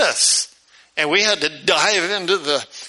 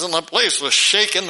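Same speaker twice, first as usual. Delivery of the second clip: ordinary talk, nothing marked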